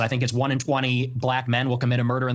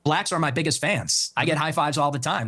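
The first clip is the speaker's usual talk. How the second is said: ordinary delivery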